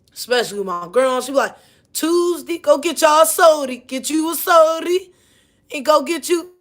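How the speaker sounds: usual speaking voice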